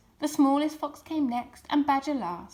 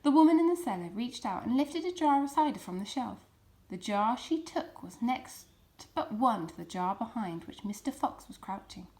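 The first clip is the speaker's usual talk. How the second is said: ordinary delivery, nothing marked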